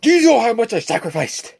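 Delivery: pretending to yell back at his boss in Osborn's voice; mock Osborn outburst. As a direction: stand up and fling it at the boss. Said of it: "I should just stand up and go".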